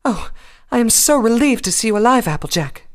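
loud, high, dramatic relief; overjoyed and theatrical; relieved gushing. Acted. Rarity greets recovered friend.

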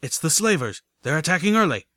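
yelling that an attack has started; panicked yell. Acted. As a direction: call out an urgent warning. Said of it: "I could hear some pony yelling".

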